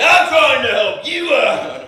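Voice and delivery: slow, loud, high mocking of the clerk.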